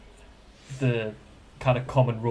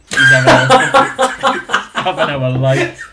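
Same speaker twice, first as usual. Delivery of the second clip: laughing; 2 semitones higher, 10 dB louder, slower than usual. Real, not acted. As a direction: laugh through the words. Laughing hard.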